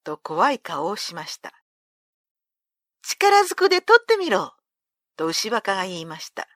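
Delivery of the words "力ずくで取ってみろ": a loud, high challenge flung upward; bold dare. A narrator's reading. Young Ushiwaka taunts the monk Benkei: loud, high bold dare. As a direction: dare him to try it.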